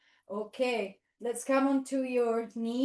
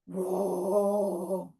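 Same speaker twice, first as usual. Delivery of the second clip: low, drawn-out roar; big animal roar; 4 semitones lower than usual. Pretend. Unknown beast heard by the dogs, long roar. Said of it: "Where's the big roar?".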